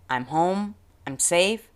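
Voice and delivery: ordinary delivery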